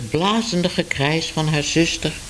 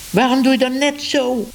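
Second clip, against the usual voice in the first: much higher, sharp accusing question; accusing outburst; 10 semitones higher than usual. A narrator's reading. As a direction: demand an answer. Sister accuses Minoes. (scherp, hoog verwijt)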